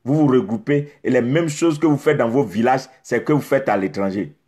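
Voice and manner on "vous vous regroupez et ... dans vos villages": ordinary delivery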